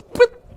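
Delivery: high, loud bird chirp; a sharp high birdlike pip; mama eagle pip. Acted. Mother eagle pushing her chick out with a chirp.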